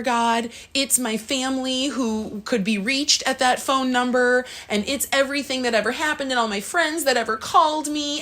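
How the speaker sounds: put-on a small child defending what it loves to God; little mind pleading. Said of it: "my little mind was like".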